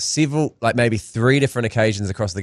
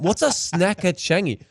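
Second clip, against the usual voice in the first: slow, higher puzzled question; mock bafflement; 5 semitones higher, 3 dB quieter, slower than usual. Foreigner hears crowd yelling unknown brand, mock bafflement. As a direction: act out a bewildered visiting comic.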